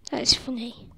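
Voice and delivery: hushed whisper. Real, not acted. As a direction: say it under your breath. Quiet aside while playing.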